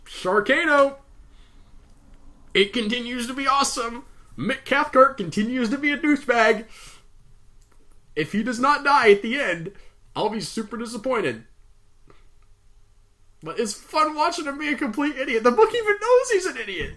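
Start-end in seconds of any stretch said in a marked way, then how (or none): 0.0-9.7 excited. Real, not acted. Excited vlog outro. 13.6-16.9 excited. Real, not acted. Gleeful comment.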